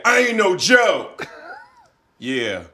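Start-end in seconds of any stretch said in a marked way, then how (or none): 0.0-1.3 slow shouted rap-star line; [name] impression. Shown as a demonstration. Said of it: "I wanna be [name]".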